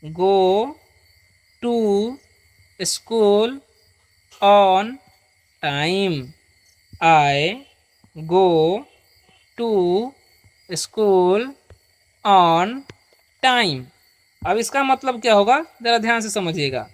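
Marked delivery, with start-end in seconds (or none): 0.0-6.2 one word at a time, long pauses between; read-along model. Shown as a demonstration. Teacher leads students to read along, slow word by word. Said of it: "आप लोग भी मेरे साथ पढ़िए". 7.0-13.8 one word at a time, long pauses between; read-along model. Shown as a demonstration. Teacher leads students to read along, slow word by word. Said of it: "आप लोग भी मेरे साथ पढ़िए".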